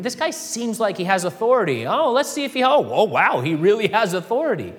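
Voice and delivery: mock wonder rising high; gasping surprise of the crowd; amazed onlookers. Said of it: "It's like".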